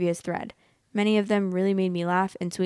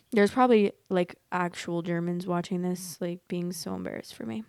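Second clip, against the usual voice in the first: usual speaking voice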